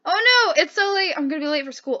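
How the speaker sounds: high, loud mock panic; hammed-up late-for-school panic; panicked. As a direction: cry out in a fluster, settling slightly. Acts waking up late for school.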